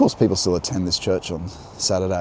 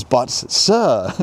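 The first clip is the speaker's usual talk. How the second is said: hammed-up French accent, raised pitch; 5 semitones higher, 5 dB louder than usual. King's envoy protests to Rothschild, mock French accent. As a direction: plead in a put-on French accent. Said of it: "that's my best French accent".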